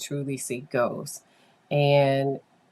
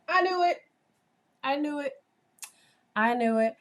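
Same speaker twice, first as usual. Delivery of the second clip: triumphant; 11 semitones higher, faster than usual. Retold or imitated. Vindicated on hearing mother's confirmation.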